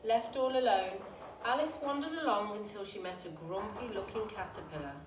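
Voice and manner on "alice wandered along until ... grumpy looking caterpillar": plain reading voice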